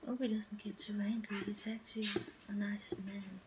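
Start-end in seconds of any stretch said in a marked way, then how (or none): none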